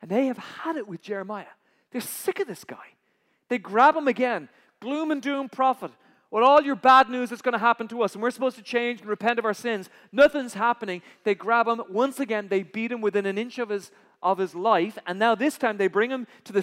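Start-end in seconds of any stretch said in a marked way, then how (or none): 4.8-11.0 loud, jeering accusation; mocking crowd. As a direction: sneer it at him like an angry mob.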